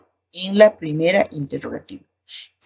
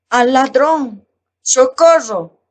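a touch louder, socorro slowed; mock cry for help; 5 semitones higher, 4 dB louder, slower than usual. Shown as a demonstration. Teacher acting out a cry for help in a lesson, mild mock shout. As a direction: call for help as an example, only half-shouted. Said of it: "Observamos los ejemplos".

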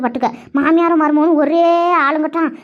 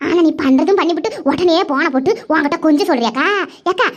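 ordinary talk, nothing marked

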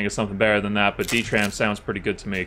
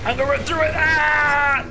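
much higher, louder charge-in cry; high excited yell; 6 dB louder than usual. Real, not acted. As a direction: yell while rushing in. Charging through electricity.

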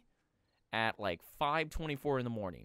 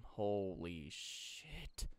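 low, soft, very drawn-out exclamation; weary dismay; 4 semitones lower, 6 dB quieter, much slower than usual. Real, not acted. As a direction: stretch each word out slowly and quietly. Dismayed mutter at the mess.

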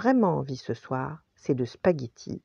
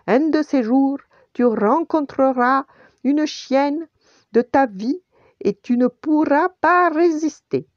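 high, loud teasing send-off; calling after; 7 semitones higher, 6 dB louder than usual. A narrator's reading. Tony teases the departing dog, calling loudly. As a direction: keep calling out to someone walking away.

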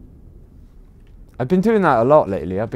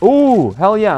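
excited; 8 semitones higher, 7 dB louder, slower than usual. Real, not acted. Excited at loot pickup.

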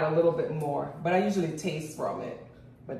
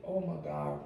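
soft, low words through food; mouth full; 3 semitones lower, 9 dB quieter than usual. Real, not acted. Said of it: "talking with food in my mouth".

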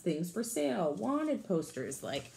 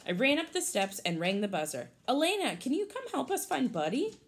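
lively storybook read for a class; picture-book read-aloud voice; reading to children; 3 semitones higher than usual. Acted. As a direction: read it brightly to the kids.

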